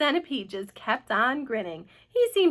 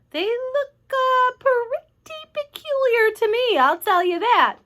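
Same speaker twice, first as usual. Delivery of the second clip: very high, slow, stretched-out voice; an onlooker peering and reporting slowly; puzzled onlooker; 13 semitones higher, 7 dB louder, slower than usual. A narrator's reading. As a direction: draw it out high and loud.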